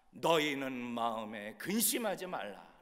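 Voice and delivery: slow, firm declaration of the verse; scripture proclamation. Acted. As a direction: proclaim it slowly in a full voice. Scripture declared, then echoed softly. (천천히 힘 있게 선포하는 성경 말씀)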